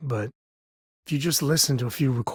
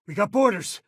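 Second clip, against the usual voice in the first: raised, higher urgent call; alarmed warning; 9 semitones higher than usual. Acted. Companion warns crew of intruders aboard, urgent raised call. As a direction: warn the crew of boarders. Ship being boarded.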